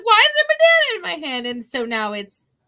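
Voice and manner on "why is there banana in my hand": high, fast confused whine; baffled question. Retold or imitated. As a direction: rush it out, utterly puzzled. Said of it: "I was like".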